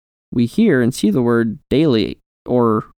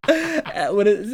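giggling through the words; laughing; 8 semitones higher, much slower than usual. Real, not acted. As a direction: laugh as you speak.

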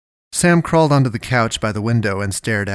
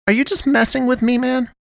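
very high, indignant question; demanding. A narrator's reading. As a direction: demand an answer, pitched right up. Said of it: "Sam demanded".

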